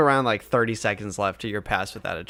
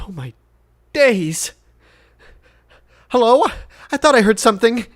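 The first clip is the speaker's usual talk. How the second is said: loud, high-pitched call of a woken lord; startled old-fashioned lord; 11 semitones higher, 9 dB louder than usual. Acted. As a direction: call out, roused and wary, as the lord.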